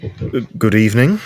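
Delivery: awkward attempt at a casual greeting; uncomfortable. [name] greets muddy teenager, failing to seem comfortable. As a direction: try to sound at ease and fail a little. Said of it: "trying to be comfortable in this situation".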